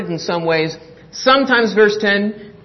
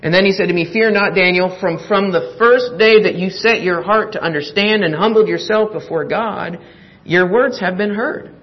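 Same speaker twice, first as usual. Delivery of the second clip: solemn Bible reading, a bit louder; scripture reading; 2 semitones higher, 4 dB louder than usual. Acted. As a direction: read the angel's words with weight. Reads the angel's words aloud.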